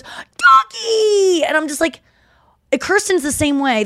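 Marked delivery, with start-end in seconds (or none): 0.0-1.4 high, loud, gushing squeal; mock-ecstatic shriek; imitated dog-lover squeal. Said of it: "every time she sees a dog, she goes".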